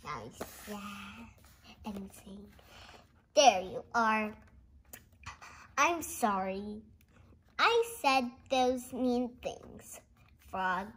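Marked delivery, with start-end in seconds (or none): none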